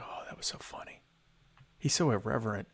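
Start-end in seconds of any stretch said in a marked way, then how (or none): 0.0-1.0 whisper. Retold or imitated. Whispered retold reaction.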